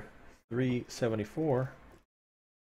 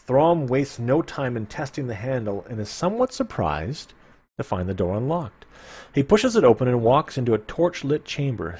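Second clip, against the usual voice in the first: story narration; 2 semitones higher, 5 dB louder than usual. Acted. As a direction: paint the dank cavern for listeners. Reading gamebook narration.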